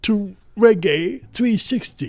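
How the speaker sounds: high-pitched presidential impression; Bill Clinton. Guest giving the podcast a shout-out, presidential impression. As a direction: finish the shout-out as a mock president. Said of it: "Yes, Bill Clinton".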